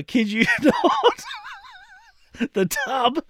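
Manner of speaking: slow, high punchline through laughter; drawn-out reveal breaking into real laughter; laughing while talking. Real, not acted. Cracking up delivering the punchline.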